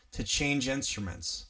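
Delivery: ordinary delivery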